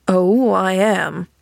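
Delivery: slow, sour and grim; grim retort. A narrator's reading. Injured man retorting to cheerful girl, grimly. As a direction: drag it out with grim irony. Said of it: "retorted the man grimly".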